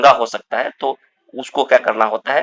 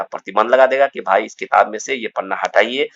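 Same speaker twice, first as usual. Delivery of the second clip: ordinary delivery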